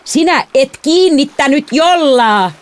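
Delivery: slow, high-pitched angry yell; outraged shout; furious roar. A narrator's reading. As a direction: bellow the accusation, stretching the words. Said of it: "minä karjuin".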